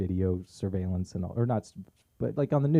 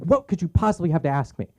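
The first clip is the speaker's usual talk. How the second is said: fuming mad; 8 semitones higher, 4 dB louder than usual. Retold or imitated. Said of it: "fuming mad".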